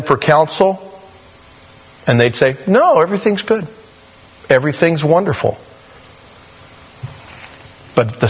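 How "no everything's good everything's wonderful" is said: high-pitched, airy false comfort; breezy reassurance. Acted. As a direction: wave every worry away as a glib counselor. False counselors giving empty reassurance.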